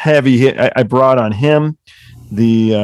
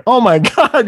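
higher, laughing disbelief; laughing oh my god; 11 semitones higher than usual. Retold or imitated. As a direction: laugh it out, moved and amazed. Moved by the Van Halen story.

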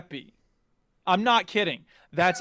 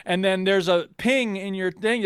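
ordinary talk

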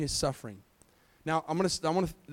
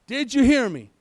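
usual speaking voice

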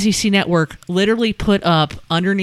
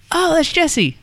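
higher-pitched greeting, mildly acted; delighted recognition; 10 semitones higher than usual. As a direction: retell spotting a familiar person, pitched up. Retold recognition at table.

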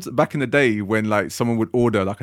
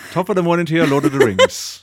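put-on Irish accent; 5 semitones higher, 3 dB louder than usual. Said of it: "Apologies to all our Irish listeners".

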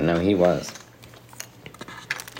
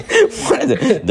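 laughing; 7 dB louder, much slower than usual. Real, not acted. Laughing at his failed accent.

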